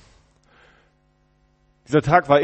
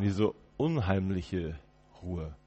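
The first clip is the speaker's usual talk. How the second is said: slow and hushed, fading out; eerie hush; 4 semitones lower, 8 dB quieter, much slower than usual. Acted. Prisoner describing the uncanny night silence, slow hush. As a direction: let the eerie silence swallow the words.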